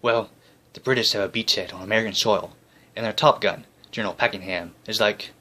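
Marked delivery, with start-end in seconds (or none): none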